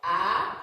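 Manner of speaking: A sliding up from low; rising second tone. Shown as a demonstration. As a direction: glide A upward. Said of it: "Follow me".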